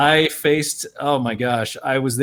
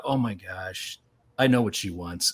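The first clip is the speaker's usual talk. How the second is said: quiet realization; 7 semitones lower, 8 dB quieter than usual. Retold or imitated. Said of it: "I sat there and I thought".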